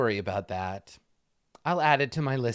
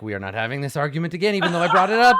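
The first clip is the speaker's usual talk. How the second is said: rising argument; 6 semitones higher, 8 dB louder, much faster than usual. Acted. Married couple starts arguing.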